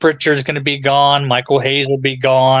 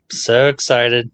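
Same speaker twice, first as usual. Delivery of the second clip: slow, deadpan mock enthusiasm; sarcastic fake excitement; 3 semitones lower, slower than usual. Host sarcastic about wrestling in WWE, deadpan. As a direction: drag it out with no excitement at all. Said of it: "hear the excitement in my voice".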